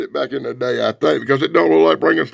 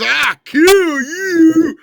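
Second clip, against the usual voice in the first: slow, much higher and louder monster roar; monster growl; 9 semitones higher, 6 dB louder, much slower than usual. Retold or imitated. Frankenstein threatens the doctor, slow monster roar. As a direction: bellow it as the angry monster. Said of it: "Frankenstein says".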